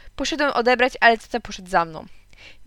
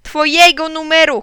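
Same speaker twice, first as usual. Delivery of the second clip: slow, high, loud shout; 7 semitones higher, 10 dB louder than usual. A narrator's reading. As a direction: shout each word slowly down the line. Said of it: "krzyknąłem". (powolny, głośny krzyk)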